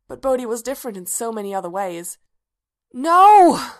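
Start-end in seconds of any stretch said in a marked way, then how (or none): 2.9-3.7 loud high nightmare scream. A narrator's reading. Bodhi trapped in a nightmare, screaming. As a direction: cry out at full force, pitched way up. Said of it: "Now he was screaming".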